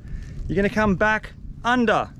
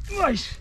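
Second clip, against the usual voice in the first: whoosh sound; 9 semitones higher than usual. Real, not acted. Showing how tight the line is.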